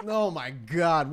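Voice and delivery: slow, drawn-out disbelief at the dice roll; amazed. Real, not acted. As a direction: let the amazement stretch out. GM sees six successes.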